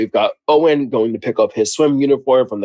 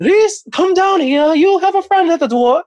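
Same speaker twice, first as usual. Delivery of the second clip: Lady Sterling voice. Retold or imitated. Mother calls her son down to greet the new friend, in a high grand voice. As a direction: sing it out like a posh hostess summoning her son. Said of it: "Lady Sterling voice".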